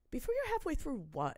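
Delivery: pointed confused question; mock baffled question. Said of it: "I'm like".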